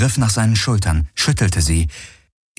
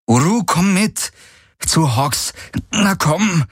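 high, insistent coaxing order; urging command; 10 semitones higher, slower than usual. A narrator's reading. Kirai tugs the bridle of her mount, urging. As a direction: urge the animal along. Urges animal while tugging bridle.

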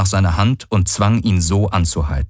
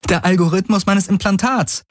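high, relieved realization; relieved, lifted character voice; 13 semitones higher than usual. A narrator's reading. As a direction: say it high, as the answer dawns. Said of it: "diesmal erleichtert".

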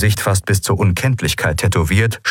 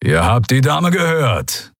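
slow, raised creature growl; growled order; 5 semitones higher, slower than usual. A narrator's reading. Furred fighter backs up his captive's answer, growling. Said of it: "knurrte Keru".

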